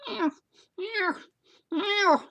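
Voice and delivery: short, very high-pitched meows; cat meowing. Retold or imitated. Storyteller showing how the cat went at his wife, high meowing. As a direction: imitate an insistent cat in a tiny high voice. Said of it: "started going like this".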